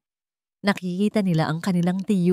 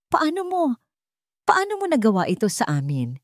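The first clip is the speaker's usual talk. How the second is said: crying question; 6 semitones higher than usual. Acted. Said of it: "Umiiyak na tanong ni Liwayway".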